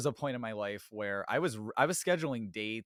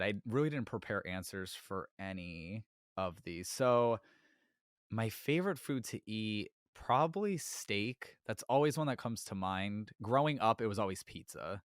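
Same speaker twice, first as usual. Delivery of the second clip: ordinary talk, nothing marked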